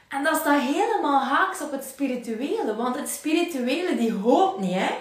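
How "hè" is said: usual speaking voice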